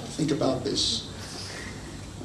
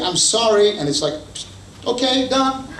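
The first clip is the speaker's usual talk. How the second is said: higher, louder breezy okay; quick forgiveness; 9 semitones higher, 4 dB louder than usual. Pretend. Gas-stove spouse forgiving in a flash, higher and bright. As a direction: brush it off brightly.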